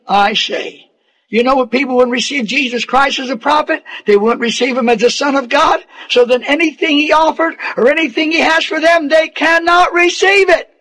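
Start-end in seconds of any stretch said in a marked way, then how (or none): 1.3-10.7 faster, climbing higher to a peak; building preaching. Acted. Preacher telling the congregation about rejecting Jesus, faster and climbing. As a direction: race up into the climax.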